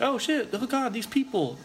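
high-pitched mock panic; mock fear. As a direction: act scared, pitch shooting way up. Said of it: "that you're afraid".

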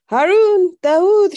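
very high, loud holler of names; calling out. A narrator's reading. Sister summoning brothers in case they're needed, loud call. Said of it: "she called".

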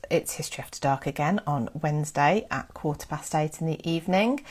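ordinary talk

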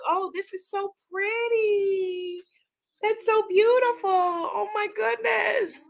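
high, slow, drawn-out admiration; high, awed cooing; real gushing delight. Real, not acted. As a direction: swoon over it in stretched words. Gushes over the pretty book.

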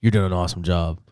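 deep put-on voice, paying a compliment; Bob Pompiani. TV man praising the wedding DJ, imitated in a low voice. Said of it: "and he goes".

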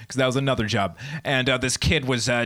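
usual speaking voice

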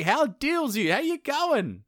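high-pitched eager hello; effusive greeting. Acted. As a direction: greet a relative with hammed-up enthusiasm. Falcon greets his brother-in-law.

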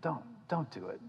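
hushed, begging voice; gentle plea; soft pleading. Retold or imitated. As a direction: beg quietly. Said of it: "Isaiah's just begging him".

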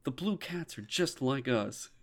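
quiet, slow imitation; mock line said softly and slowly. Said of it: "And it's like".

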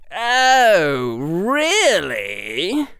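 loud, high, drawn-out drawl; exaggerated sarcastic drawl. A narrator's reading. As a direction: draw the words out with arch interest. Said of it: "in the loudest drawl Harry had ever heard".

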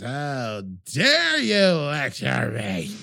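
slow, drawn-out hammy outrage; over-dramatic outrage. Acted. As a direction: chew the scenery on every word. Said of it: "chewing the scenery, way over dramatic".

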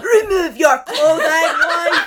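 loud, high bellow breaking into laughter; hammed-up Viking husband yelling; yelled Viking command. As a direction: bellow the order, barely holding back laughs. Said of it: "Just yell everything that he says".

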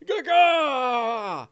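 high strangled yelps; ogre's pained cries. Acted. Burned ogre dropping his club, high pained cries. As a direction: yelp out in pain, drawn out. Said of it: "the sacred flame burns over".

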